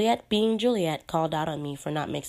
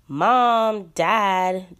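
loud call across the house; yelling; 4 semitones higher, 8 dB louder than usual. Acted. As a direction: shout for the parents, full voice. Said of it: "Juliet yells".

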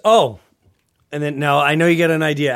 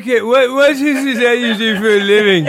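puppet on a date; 9 semitones higher, 8 dB louder than usual. Pretend.

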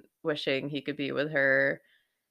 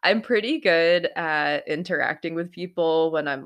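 ordinary delivery

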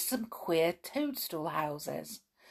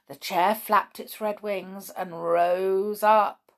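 plain reading voice

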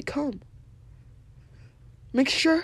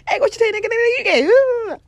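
high, loud hammed-up cartoon street talk; Boondocks cartoon voice; 13 semitones higher, 7 dB louder than usual. Imitating Boondocks characters to listeners, high hammed-up cartoon voice. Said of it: "how they talk in the Boondocks".

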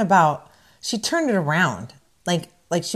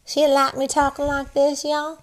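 put-on Southern accent; 6 semitones higher than usual. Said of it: "using that accent".

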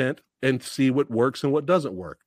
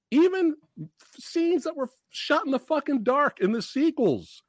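high-pitched exasperated ranting; mad rant; 8 semitones higher than usual. Real, not acted. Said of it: "I've been that mad".

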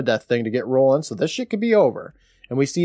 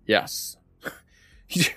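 ordinary delivery

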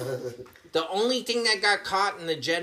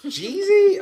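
surprised; 10 dB louder than usual. Real, not acted. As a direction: squeal the name in disbelief. Friend suggests rapper lookalike.

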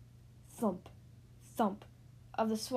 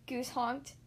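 ordinary delivery, nothing marked

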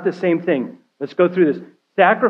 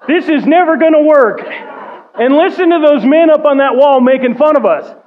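much higher and louder, laughing disbelief; frustrated griping; 9 semitones higher, 6 dB louder than usual. Retold or imitated. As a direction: rant about the mocking men on the wall. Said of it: "I would be marching around going".

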